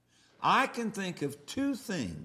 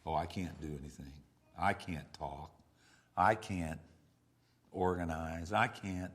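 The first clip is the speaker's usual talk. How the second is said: lightly voiced whiny defeatist, lower and softer; mock the self-pitying relative, a bit faster; imitating a defeatist relative; 11 semitones lower, 3 dB quieter than usual. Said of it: "family members like that".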